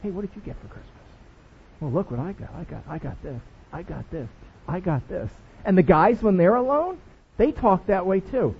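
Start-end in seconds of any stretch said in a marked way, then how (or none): 0.0-5.3 much softer, lower hushed ladies' chatter; gossiping ladies. Retold or imitated. Church ladies by the kitchen comparing gifts, hushed. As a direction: lean in and trade gift news in a hush. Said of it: "one of you goes".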